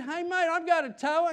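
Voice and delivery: high, loud call through the window; loud friendly call from a car. Retold or imitated. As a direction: shout cheerily to the hitchhiker. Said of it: "I said".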